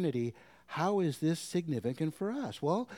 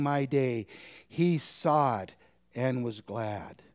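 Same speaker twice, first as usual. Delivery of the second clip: preacher voicing Jesus with full force; forceful scripture reading; 3 semitones higher, 5 dB louder than usual. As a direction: proclaim it, slowing for Abraham. Reads Jesus' words with force.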